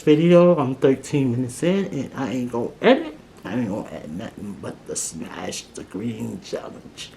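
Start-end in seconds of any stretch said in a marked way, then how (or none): 3.4-7.1 low, quiet mumble; mumbling. Real, not acted. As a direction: mumble it under your breath. Mumbled while eating.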